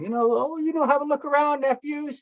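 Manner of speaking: high, quick call to nephews; acted uncle. Uncle to workshop youth, acted in a high, coaxing voice. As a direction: re-enact an uncle getting the youngsters to look around, pitched high.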